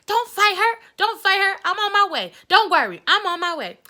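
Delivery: high, loud, urgent orders; mother rushing to stop a fight; urgent mom. Retold or imitated. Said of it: "My mama was like".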